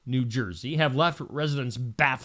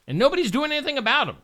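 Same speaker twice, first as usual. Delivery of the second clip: exasperated rant; 10 semitones higher, much faster than usual. Real, not acted. Exasperated about drones.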